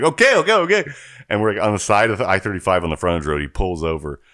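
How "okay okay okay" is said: high, louder, rushed okays; flustered driver. Retold or imitated. Uber driver agreeing to pull over, high and flustered. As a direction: pitch up like a startled driver. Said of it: "He's like".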